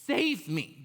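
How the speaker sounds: high, loud burst on the first word; imitated desperate child; mock screamed plea. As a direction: beg a stranger, hammed up. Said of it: "I'm screaming at a stranger".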